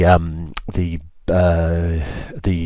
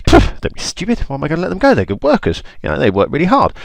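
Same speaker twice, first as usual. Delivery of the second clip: high, loud, dismissive puff; mock-indignant, pitched-up scoff; scoffing Pharaoh; 10 semitones higher, 6 dB louder than usual. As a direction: brush him off incredulously. Pharaoh scoffs at Moses' demand.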